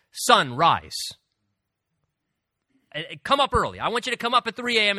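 loud, bossy command, rushing at the end; mock order to the sun. Sleepless man commanding the sun to rise early, loud bossy shout.

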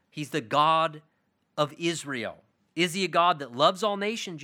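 ordinary delivery

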